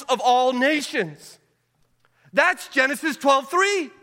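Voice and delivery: rally the room to go; preaching at full force. Acted. Preacher rallying the congregation to bless others.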